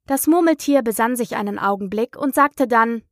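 ordinary delivery, nothing marked

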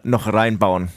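usual speaking voice

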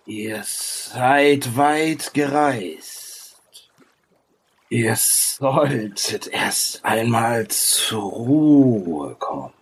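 slow, swelling then sinking phrases; ancient creature sage. Acted. Sage tells weary travellers to rest, slow and grave. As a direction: stretch the words with long pauses. Game master voices the sage.

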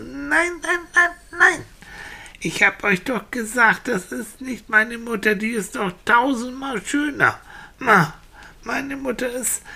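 put-on high-pitched childish protest, stretched no's; exasperated little boy. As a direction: insist like a small boy not being believed. (kleiner Junge, empört und beharrlich)

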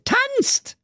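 put-on girl repeating tanzt at the top of her voice. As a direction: push the second tanzt up into a squeal. Repeated command.

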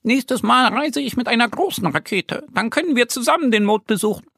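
high little voice, fast and happy; moon man calling. Acted. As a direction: call out a cheerful goodbye as the tiny man. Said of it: "winkt den beiden Freunden und ruft".

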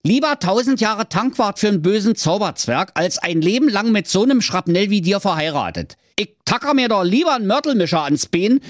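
high, mildly gruff ranting hero; a hammed-up huffy tirade; snapping at her. Marco rants at the princess, a mild mock snap. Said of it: "blaffte die Prinzessin an".

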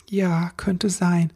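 small embarrassed concession; somewhat embarrassed. Acted. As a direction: admit it reluctantly and bashfully. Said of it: "meinte Ribbelwitsch etwas verlegen".

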